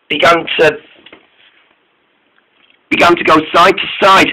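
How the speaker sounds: plain reading voice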